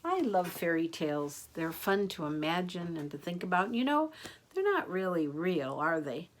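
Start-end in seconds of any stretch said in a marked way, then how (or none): none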